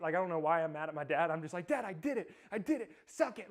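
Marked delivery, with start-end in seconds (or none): 1.7-3.5 high, loud triumphant crowing; yelling down the phone to dad, triumphant; gleeful gloat. Retold or imitated. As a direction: rub it in to dad with glee.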